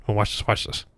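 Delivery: hushed, low and soft; quiet. Real, not acted. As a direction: murmur it under your breath. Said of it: "I'm gonna be quiet for the next part".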